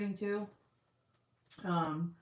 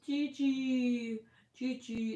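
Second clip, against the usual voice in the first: put-on higher, childlike begging voice; imitating the toddler; 6 semitones higher, 3 dB louder, much slower than usual. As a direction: beg like a little boy asking to nurse. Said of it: "And he goes".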